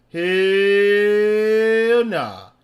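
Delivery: long loud drawn-out hell nah. Real, not acted. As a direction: shout it in disbelief. Reacting loudly to video.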